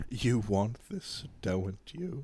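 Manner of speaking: slow, quiet sinister tempter; lightly voiced scheming emperor; tempting devilish whisper. Palpatine tempting the listener.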